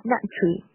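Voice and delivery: "nut tree" with the first T held, not released; unreleased T. Shown as a demonstration. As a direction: run "nut tree" together on one T. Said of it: "不需要把这个t给读出来". (nut tree第一个t不读出来)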